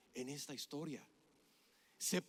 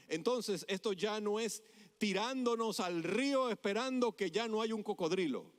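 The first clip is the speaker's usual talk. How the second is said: ordinary delivery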